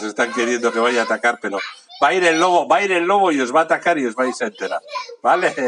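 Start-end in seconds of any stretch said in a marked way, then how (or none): 2.0-5.5 put-on loud, high wolf threat to kids; big bad wolf voice. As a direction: boom it out like the wolf coming.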